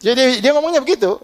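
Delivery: high laughing disbelief; laughing amazement. Real, not acted. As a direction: laugh it out, amazed at the friend's kindness. Amazed laugh retelling friend.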